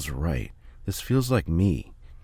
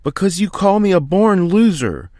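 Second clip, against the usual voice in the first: much higher, much louder furious screech; 13 semitones higher, 9 dB louder than usual. Acted. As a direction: scream it at your father, finally breaking. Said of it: "Philly screeched".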